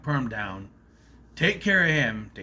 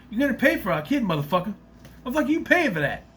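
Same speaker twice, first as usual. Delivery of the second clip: loud and higher, pushing the demand harder; shrieking demand; 9 semitones higher, 4 dB louder, faster than usual. Retold or imitated. Baby mama yelling at him to pay, higher loud demand. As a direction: rise up high and hammer the demand. Same line redone with the substitute word.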